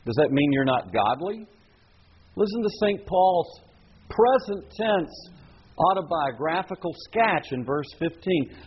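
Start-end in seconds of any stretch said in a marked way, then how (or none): 2.3-5.3 "present tense" drawn out slowly and lifted; stress on present tense. Shown as a demonstration. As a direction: lean hard on "present tense". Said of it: "notice the change in tense".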